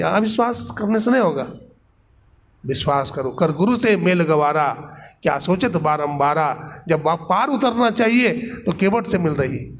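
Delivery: usual speaking voice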